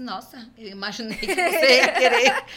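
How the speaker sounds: the boss laughing at her eagerness. Retold or imitated. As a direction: chuckle out the boss's reply.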